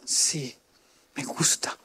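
put-on a child confessing a crush in a whisper; shy whisper. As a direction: breathe it out like a bashful kid.

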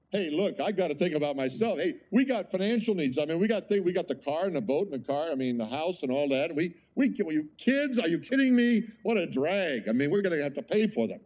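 high, whiny mock of selfish parents; mocking worldly people. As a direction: parody the people who think this way. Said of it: "there are people talk like this".

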